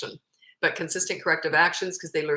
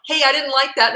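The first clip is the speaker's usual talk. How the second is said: raised, higher scolding voice; demonstrated yell; 7 semitones higher, 7 dB louder than usual. Shown as a demonstration. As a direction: yell it at a child as an angry example. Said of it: "Whereas if I was to yell".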